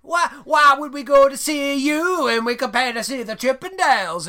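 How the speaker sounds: high, loud, mock-outraged ladies; hammed-up shrieky mockery of indignant ladies; scoffing ladies. Local ladies scoffing at the men's strip show, mock-outraged.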